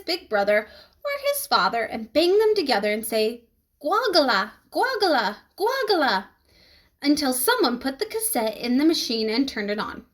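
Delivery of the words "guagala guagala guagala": put-on rising, insistent baby babble; toddler voice. A narrator's reading. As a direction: chant it like a demanding toddler. Toddler demanding music.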